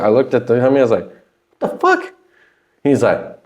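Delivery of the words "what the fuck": high shocked what the fuck; shocked disbelief. Retold or imitated. Rapper walking in sees an old engineer, shocked and high. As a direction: squeak out the disbelief.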